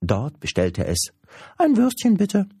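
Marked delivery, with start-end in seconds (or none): 1.6-2.5 very high, loud, quick squeaky creature voice; high-pitched, eager creature chatter; cheeky little creature. Acted. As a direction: pipe up like an impish little creature.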